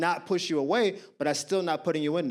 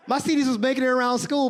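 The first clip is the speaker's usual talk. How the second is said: ordinary talk, nothing marked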